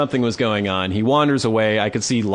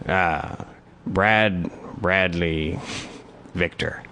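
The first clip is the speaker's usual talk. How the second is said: mumbling homeless man; 6 semitones lower, 6 dB quieter, much slower than usual. Retold or imitated. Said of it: "He's like".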